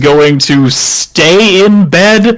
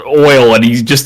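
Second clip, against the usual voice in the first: ordinary delivery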